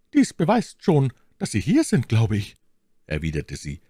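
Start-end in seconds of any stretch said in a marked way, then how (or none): 0.1-2.6 high, coy lady's voice; feigned embarrassment. A narrator's reading. Milady answers her suitor, feigning embarrassment. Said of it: "Verlegenheit heuchelnd".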